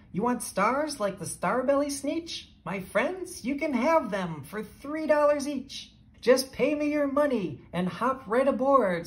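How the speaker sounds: a lightly voiced pushy peddler; eager salesman. A narrator's reading. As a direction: push the deal on the crowd. McBean selling stars.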